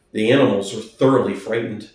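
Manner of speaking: ordinary delivery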